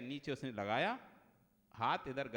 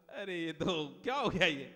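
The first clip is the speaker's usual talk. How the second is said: ordinary talk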